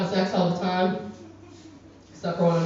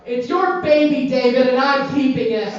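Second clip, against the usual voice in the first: yelling out; 5 semitones higher, 6 dB louder than usual. Retold or imitated. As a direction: call it out for the whole room to hear. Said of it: "so I yell out".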